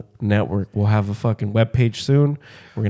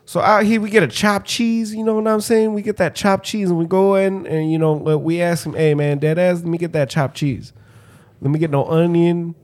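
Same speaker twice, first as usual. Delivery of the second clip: put-on high-pitched Brooklyn kid voice; mock Brooklyn kid; 6 semitones higher than usual. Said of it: "you get the Brooklyn kid".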